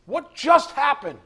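usual speaking voice

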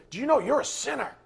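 loud, high, fast mock accusation. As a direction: pounce on them like an overzealous accuser. Acted confrontation of a stranger.

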